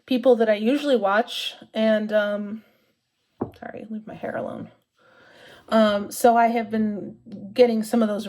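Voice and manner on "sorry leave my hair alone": quick unvoiced whisper, half to herself; whispered aside. Real, not acted. Whispering aside to whoever is tugging her hair. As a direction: hush it out as a side remark.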